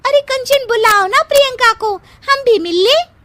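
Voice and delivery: high, eager pleading; eager asking. Acted. Friend eagerly asking Kanchan to call the bride. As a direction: press her friend to fetch the bride.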